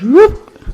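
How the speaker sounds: sudden high loud whoop; playful sound effect of the head flying off. Acted. Head flicked off in story.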